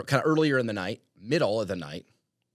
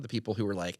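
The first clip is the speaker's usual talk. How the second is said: ordinary delivery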